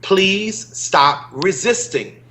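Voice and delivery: usual speaking voice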